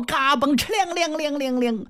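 high vocal sword ring; storyteller's sword sound effect; sword-drawing noise. Acted. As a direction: ring it out like steel. Sword drawn, sound imitation.

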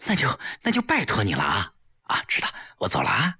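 fast, eager thanks tumbling out; excited. A narrator's reading. Grateful wife thanking her friend as she leaves, excited. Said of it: "激动极了".

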